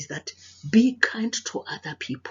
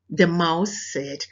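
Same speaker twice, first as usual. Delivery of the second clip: plain reading voice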